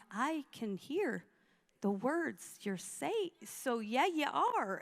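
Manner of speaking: slow, measured call-out; pointed contradiction. Retold or imitated. Woman to angry lady denying it, slow pointed reply. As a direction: space out the words as if explaining the obvious. Calling out the lady's denial.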